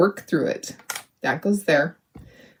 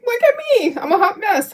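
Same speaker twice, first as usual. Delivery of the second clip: much higher, playful wail on "Look at me"; mock dramatic squeal; 12 semitones higher than usual. Real, not acted. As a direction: throw "Look at me" up high in mock despair.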